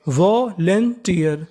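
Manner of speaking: Volunteer slowly split as vo-len-tiyar; syllable by syllable. Shown as a demonstration. Teacher showing learners how the word builds, syllable by syllable. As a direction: draw out each part of volunteer: vo, len, tiyar. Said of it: "तो meaning बनी".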